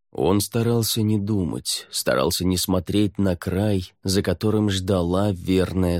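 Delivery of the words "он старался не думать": ordinary delivery, nothing marked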